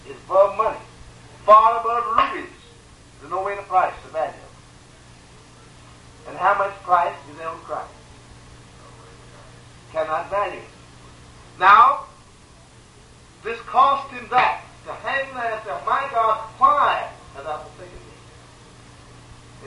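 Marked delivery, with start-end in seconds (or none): 3.2-6.3 hushed. Real, not acted. Preacher hushes on a point. 15.9-19.7 very slow, every word stretched out; a slow, aching lament; drawn-out plea. Retold or imitated. Christ's cry quoted again.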